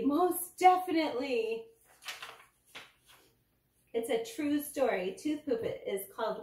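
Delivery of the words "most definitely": high, loud, slow and emphatic; excited exclamation. A narrator's reading. Danny agreeing to brush and floss, excited. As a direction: stretch out an eager agreement. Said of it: "Danny exclaimed excitedly".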